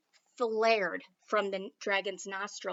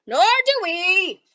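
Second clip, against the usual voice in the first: much higher, louder agreeing echo; chorus shout; 8 semitones higher, 12 dB louder than usual. Acted. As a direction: echo it together, loud and heartfelt. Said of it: "echoed the knights".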